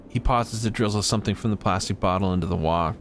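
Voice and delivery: usual speaking voice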